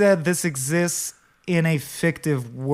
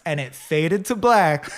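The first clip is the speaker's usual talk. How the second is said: ordinary talk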